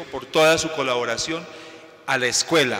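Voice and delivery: usual speaking voice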